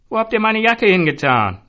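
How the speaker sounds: high, loud raging yell; furious shout at the barmen. A narrator's reading. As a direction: rage about the jacket. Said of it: "tobte Zoran".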